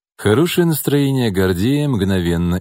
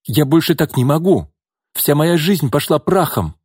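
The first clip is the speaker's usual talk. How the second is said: higher, louder, desperate cry; 7 semitones higher, 3 dB louder than usual. A narrator's reading. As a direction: shout out her despair. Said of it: "выкрикнула Нина".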